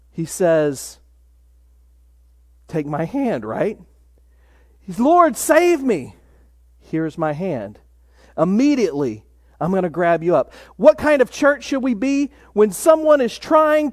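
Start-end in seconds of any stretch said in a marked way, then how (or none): 2.7-3.4 gentle offer. Retold or imitated. Jesus gently offering his hand to sinking Peter, soft and tender. 5.0-6.2 slow, higher plea, each word drawn out; pleading cry. Retold or imitated. Peter sinking in the waves begging Jesus to save him, slow and pleading. 6.8-10.5 put-on calm him like a frightened child; tender reassurance. Jesus rescuing Peter tenderly.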